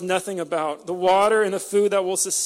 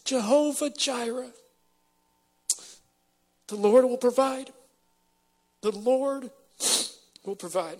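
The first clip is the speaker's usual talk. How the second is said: getting emotional; 2 semitones higher than usual. Real, not acted. Preacher moved, repeating God's promise as his voice fades. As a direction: let it catch as the promise sinks in. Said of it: "I apologize for getting emotional".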